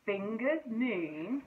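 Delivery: slow, drawn-out suspense; teasing build-up. Acted. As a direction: stretch it out to tease. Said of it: "said Barry".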